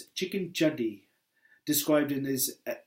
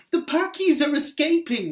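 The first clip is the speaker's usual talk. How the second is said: much higher and louder panicked movie shout; hammed-up Jurassic World alarm; alarmed movie tech; 12 semitones higher, 7 dB louder than usual. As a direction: yell it like a frantic lab tech in a film. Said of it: "one of the techs exclaims".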